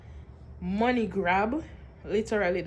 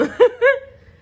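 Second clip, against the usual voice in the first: sudden high laugh; loud burst of laughter; 12 dB louder than usual. Real, not acted. Laugh after the prayer story.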